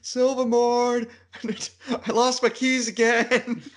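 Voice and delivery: high shout from outside the castle; calling out. Pretend. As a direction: yell up to be let in. Acted lockout skit, calling out.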